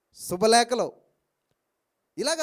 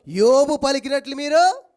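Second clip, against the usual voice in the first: louder, slow voice of God; loud, slow divine rebuke; God rebuking; 4 semitones higher, 9 dB louder, slower than usual. Acted. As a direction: speak as God rebuking the friends. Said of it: "దేవుడు అంటాడు".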